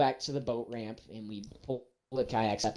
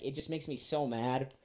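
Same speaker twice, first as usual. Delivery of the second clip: ordinary delivery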